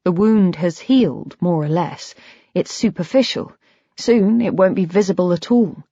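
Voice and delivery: usual speaking voice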